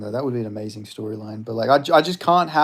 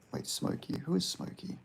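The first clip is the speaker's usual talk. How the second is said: whispered to self; 3 semitones lower, 12 dB quieter than usual. Real, not acted. Muttering over chat.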